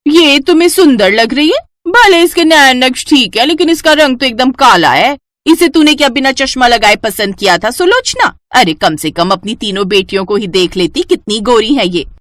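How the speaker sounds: high, loud, taunting jeer; mocking aunt. Loudmouth aunt mocking the bride's dark skin, loud and taunting. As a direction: sneer at the bride in public.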